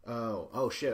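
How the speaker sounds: real surprise at his own slip; startled outburst. Real, not acted. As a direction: caught off guard by a slip. Catches himself mid-list.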